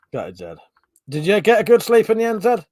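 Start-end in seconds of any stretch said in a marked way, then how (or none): 0.1-0.6 sarcastic gutted. Shown as a demonstration. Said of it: "when I say gutted, it's like a sarcastic one".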